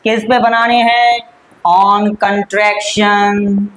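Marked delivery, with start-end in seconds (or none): 1.6-3.7 On contraction said very slowly; slow, emphatic. Shown as a demonstration. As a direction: drag out the topic for students. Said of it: "teacher slowly stresses the topic". (बहुत धीमे, जोर देकर)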